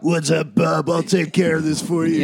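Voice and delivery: hammed-up superhero tough talk; Wolverine. Host mocking a favourite X-Men character for laughs, hammed-up impression. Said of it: "That's Wolverine".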